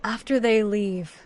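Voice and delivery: ordinary delivery, nothing marked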